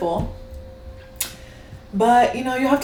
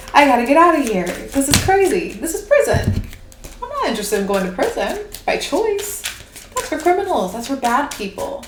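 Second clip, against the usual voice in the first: put-on higher-pitched, indignant kid protest; animated child's objection, pitched up; inner child; 4 semitones higher, 3 dB louder than usual. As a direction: protest like a child who wants out, loud at first. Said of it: "your inner child's gonna be like".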